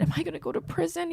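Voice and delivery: high, fast, worried blurt; panicked question. Retold or imitated. Al asking Schmidt in a fast, high, panicked blurt. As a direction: rush it out in panic.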